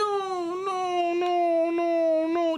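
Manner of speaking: high, drawn-out mock wailing; crazy lady. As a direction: wail each word like a hysterical woman. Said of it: "that crazy lady who said".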